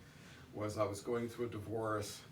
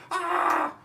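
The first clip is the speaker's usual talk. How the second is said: much higher, louder shriek of fright; retold scream; 14 dB louder than usual. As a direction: let out the aunt's sudden scream. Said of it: "she just starts screaming".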